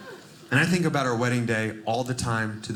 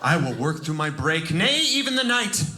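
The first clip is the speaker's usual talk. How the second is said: high, hammed-up noble maid voice; mock devoted maid; 10 semitones higher than usual. Imagined maid vowing to sanitize the room, hammed-up high. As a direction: play a maid vowing heroic duty. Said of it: "was like".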